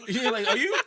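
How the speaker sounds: laughing loudly through the words; can't hold back the laugh. Real, not acted. Laughing mimic.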